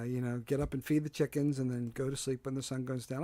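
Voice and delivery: ordinary delivery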